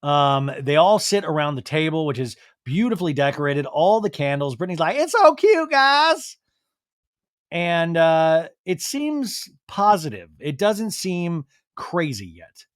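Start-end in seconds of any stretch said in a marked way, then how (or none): 5.0-6.4 high, loud excited squeal; imitating an excited girlfriend, pitched way up; gushing. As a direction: gush over something cute. Acts out Brittany gushing.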